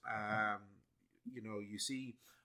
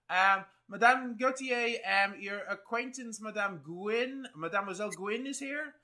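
calling out; 11 semitones higher, 8 dB louder than usual. Acted. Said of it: "you hear her calling out".

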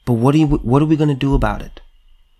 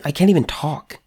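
ordinary delivery

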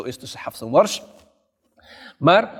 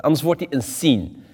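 usual speaking voice

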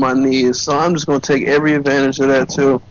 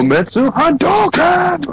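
fighting game shout; 12 semitones higher, much slower than usual. Pretend. Calling a Street Fighter special move.

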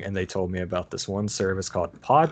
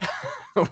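a quick high giggle; high breathy giggle; 9 semitones higher than usual. Real, not acted. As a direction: burst out giggling at the question. Laughing at the awkward question.

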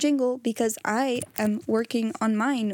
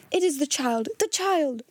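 gasped exclamation, rising higher on the repeat; 4 semitones higher than usual. A narrator's reading. Invalid telling Carmichael of the child, gasping. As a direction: gasp it out in sudden recognition. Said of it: "the invalid gasped".

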